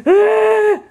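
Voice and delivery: high, short imitation wail; mock fake cry. Grandparent showing how the baby faked crying, mock wail. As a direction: imitate a baby's put-on cry. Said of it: "He's going".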